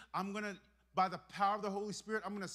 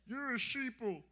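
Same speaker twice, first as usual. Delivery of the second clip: mocking insult.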